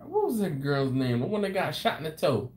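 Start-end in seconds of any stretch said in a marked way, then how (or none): none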